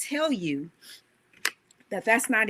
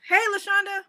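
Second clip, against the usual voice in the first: bright call to a friend, higher and louder; 10 semitones higher, 6 dB louder than usual. Pretend. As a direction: hail her across the room. Calling out to a friend.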